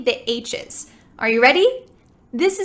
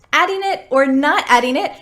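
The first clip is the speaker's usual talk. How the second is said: ordinary talk, nothing marked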